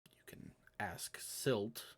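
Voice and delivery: Silt slowed and leaned on; stress on Silt. Acted. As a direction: weigh the word Silt slowly. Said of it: "he emphasizes your name".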